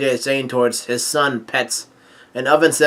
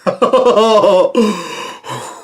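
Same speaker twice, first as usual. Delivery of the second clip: long high-pitched laugh; real laughter; 6 semitones higher than usual. Real, not acted. Reader cracking up at the ship scene, real laughter. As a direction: burst out laughing, then run out of breath.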